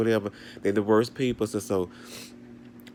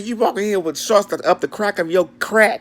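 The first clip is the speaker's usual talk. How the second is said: mock scolding; 8 semitones higher, 4 dB louder than usual. Insulting strangers, retold.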